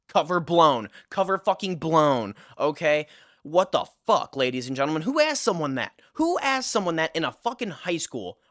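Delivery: hammed-up outburst at being found out; mock outrage. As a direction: play up the indignant disbelief. Outraged at being exposed in class.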